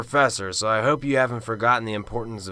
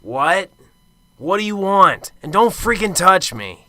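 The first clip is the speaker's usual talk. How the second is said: loud, high angry bark; startled snapping; 7 semitones higher, 7 dB louder than usual. Acted. Startled detective snaps at the professor, loud and angry. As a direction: snap at the intruder.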